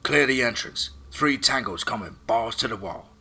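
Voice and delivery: loud, high warning over the radio; urgent shout. Acted. Chuck warning the team over comms, shouting. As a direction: bark the warning to clear out. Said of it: "Chuck called out over comms".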